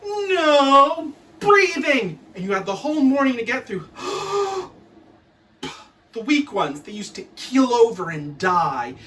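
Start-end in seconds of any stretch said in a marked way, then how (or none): 0.0-2.2 slow, loud drawn-out command; strict teacher bark. Retold or imitated. Strict teacher orders the class, slow loud bark. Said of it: "She used to stand out the front going". 3.9-4.7 high gasp for air; sharp high gasp. Retold or imitated. Pupil starved of air, high gasp.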